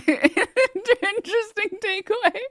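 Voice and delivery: high, slow sarcastic "Interesting takeaway"; mocking high repeat. Real, not acted. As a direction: stretch it out in mock amazement.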